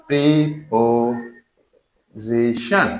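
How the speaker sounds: Preposition drawn out very slowly, syllable by syllable; slow teacherly breakdown of preposition into syllables. Shown as a demonstration. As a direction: stretch each syllable of preposition, with gaps between. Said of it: "syllable করে".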